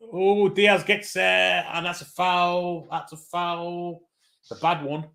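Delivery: slow, high rising call of a foul; drawn-out excited play call; excited commentary. Real, not acted. Commentator sees a foul on Diaz.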